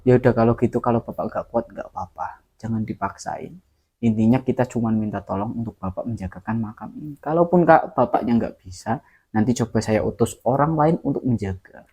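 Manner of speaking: low, soft, calm reassurance; quiet consoling. Retold or imitated. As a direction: ease him gently. Henchman calmly consoles the guard. (anak buah menenangkan Pak Yazid dengan suara pelan dan rendah)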